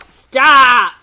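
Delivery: loud sharp shouted stop; yell. A narrator's reading. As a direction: parents yelling to halt her. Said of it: "her parents yelled".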